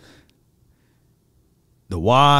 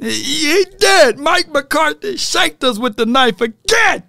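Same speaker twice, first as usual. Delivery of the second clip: high loud outburst; outraged; 11 semitones higher, 3 dB louder than usual. Real, not acted. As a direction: cry out in disbelief. Fan outburst.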